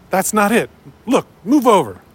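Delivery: put-on high, bossy child voice pushing in; bossy little girl. Bossy girl taking over rope.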